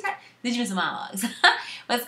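breaking into laughs mid-line; laughing question. Real, not acted. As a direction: giggle through the question. Laughing through repeat.

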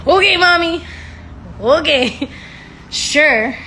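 high, loud humouring of mom; loud, sarcastic "sure, mommy" tone; mock compliance. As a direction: humour her with exaggerated agreement. Mock agreement with mother in story.

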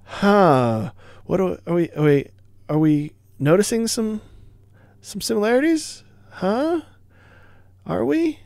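sly, rising pitch, pointed rhetorical questions; mock-innocent teasing, pitch soaring; teasing sarcasm. Real, not acted. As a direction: needle them about the obvious copy, voice climbing high. Teasing about copied game design.

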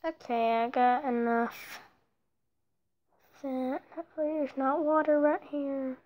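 normal talking voice

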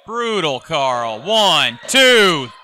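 excited ringside play-by-play; wrestling commentator. Acted. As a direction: call the near fall live. Live play-by-play of the match.

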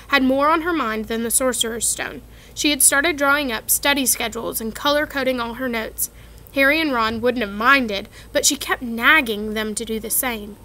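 ordinary delivery, nothing marked

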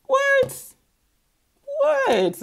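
very high-pitched "what"; incredulous high squeak; mock disbelief.

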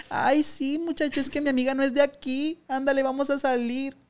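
lightly swishy, coaxing the driver; camp flirty voice. Retold or imitated.

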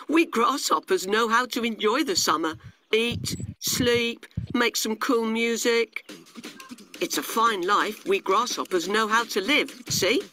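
high grasshopper character voice; carefree grasshopper, boastful. Acted. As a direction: show off the easy life. Narrator plays carefree grasshopper.

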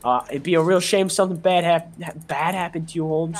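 mock-threatening Chicano homeboy. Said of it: "I lost the accent a bit".

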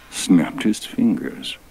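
ordinary delivery, nothing marked

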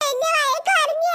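slow wail of complaint; childish crying. Acted. Scolded child complaining to mother, wailing. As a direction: bawl it with a stretched ending. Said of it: "സാരമില്ല, കരയണ്ട".

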